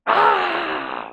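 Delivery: a sudden low, loud cry; mock demon scream. Demon shrieking at Jesus in the synagogue, low scream. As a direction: let out a possessed scream.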